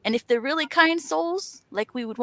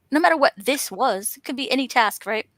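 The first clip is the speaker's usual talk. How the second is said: ordinary talk, nothing marked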